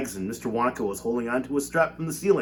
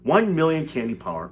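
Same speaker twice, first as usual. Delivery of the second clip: ordinary delivery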